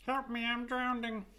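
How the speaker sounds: high, slow little drowning voice. Pretend. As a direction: cry for help as a tiny ring. Peach ring voiced drowning.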